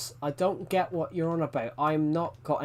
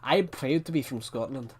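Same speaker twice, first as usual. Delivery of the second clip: put-on Scottish accent. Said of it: "Do Scottish".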